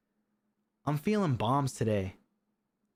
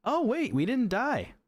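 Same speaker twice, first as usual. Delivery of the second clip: much higher, startled realization; sudden surprise; 9 semitones higher than usual. Real, not acted. Gamer realizing his team survived, startled surprise. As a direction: catch yourself mid-sigh, amazed.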